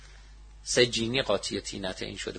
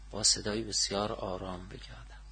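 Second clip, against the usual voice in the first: low, soft answer; very quiet voice; 4 semitones lower, 9 dB quieter than usual. Shown as a demonstration. Teacher explaining how to say the refuge formula, very quietly. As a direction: drop pitch and volume to show quietness.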